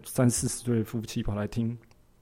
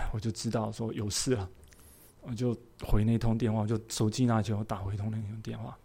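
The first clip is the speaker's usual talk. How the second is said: ordinary delivery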